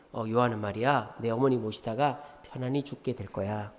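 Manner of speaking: quiet soothing Jesus; gentle reassurance. Retold or imitated. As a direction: break the news tenderly. Jesus gently explaining John's fate.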